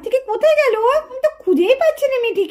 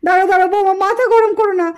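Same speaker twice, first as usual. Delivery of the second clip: ordinary talk, nothing marked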